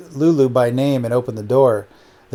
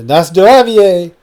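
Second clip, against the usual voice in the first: loud, high, drawn-out toast; rousing toast; 6 semitones higher, 7 dB louder, slower than usual. A narrator's reading. Crew toasting over vodka, loud drawn-out cheer. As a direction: call it out slowly, raising a glass.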